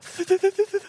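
high, stuttering mock sob; demonstrated crying. Shown as a demonstration. Boy with lip caught on his teeth, hammed-up stuttering sob. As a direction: show an exaggerated juddering cry. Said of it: "kinda show you what I was doing".

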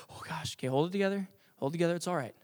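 soft, half-whispered pep talk to yourself; steady yourself under your breath; hushed self-talk. Acted. Perfectionist pitcher to himself after one ball, hushed and tense.

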